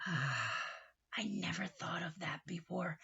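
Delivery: slow hushed whisper; soft wonder. Acted. Uncle Nacho whispers to Ambrosia, slow and soft. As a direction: breathe it out in realization.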